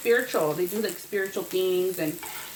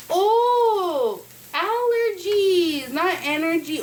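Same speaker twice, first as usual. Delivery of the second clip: loud, high, slow dawning realization; high and loud "oh, allergies" as the penny drops; 8 semitones higher, 5 dB louder, much slower than usual. Retold or imitated. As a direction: draw it out as it finally clicks. Retells sudden realization.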